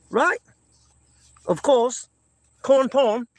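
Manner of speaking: high, playful mock English accent. Shown as a demonstration. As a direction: ham up the English accent for the chat. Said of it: "English accent, uh, impersonation".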